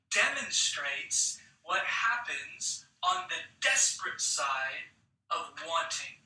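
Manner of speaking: ordinary talk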